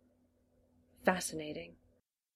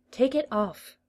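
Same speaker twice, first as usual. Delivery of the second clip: firm command, a bit higher and raised; ordering; 6 semitones higher, 3 dB louder than usual. A narrator's reading. The master tugging the jacket, ordering. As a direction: snap out the order. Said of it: "the master ordered".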